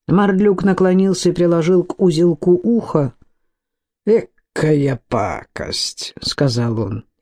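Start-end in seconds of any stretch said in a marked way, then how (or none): 4.1-6.1 low, drawn-out disgust. A narrator's reading. Mordlyuk inspects the bundle at his ear, disgusted.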